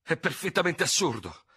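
protesting. A narrator's reading. Dubert looks for a loophole out of the duel, protesting. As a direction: dismiss the idea as nonsense.

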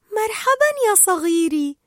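put-on high baby-talk voice; Dory, cooing greeting. Dory greeting a little jellyfish, cooing.

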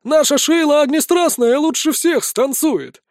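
high, boisterous boast; drunken cheering. A narrator's reading. Drunks boasting about their dancer, boisterous. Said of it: "заявляют пьяные".

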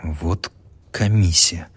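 slow sighed words; student sighing again; weary sigh. A narrator's reading. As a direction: exhale it wearily. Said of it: "опять вздохнул студент".

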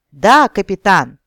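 put-on loud, slow kids' answer; shouting. A narrator's reading. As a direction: bellow it back. SpongeBob chant response.

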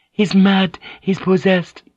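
high, loud cry of alarm. A narrator's reading. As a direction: cry it out high, for all to hear. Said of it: "Mariko cried out".

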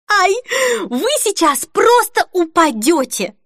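high, stretched-out excited squeal. A narrator's reading. Denise about to show her friends the dress, squealing. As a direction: squeal it, pitch soaring. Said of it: "снова взвизгнула Денис".

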